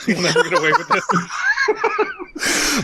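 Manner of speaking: high slow laughing villain taunt; cartoon villain, laughing; hammy villain. Villain parody line.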